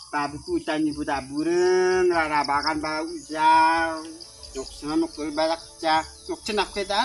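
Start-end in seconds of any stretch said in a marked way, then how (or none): none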